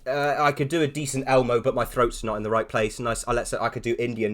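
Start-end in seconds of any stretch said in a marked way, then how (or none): none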